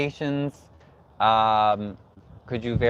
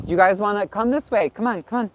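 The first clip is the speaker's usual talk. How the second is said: calling out; 8 semitones higher than usual. Real, not acted. Calling people over to interview.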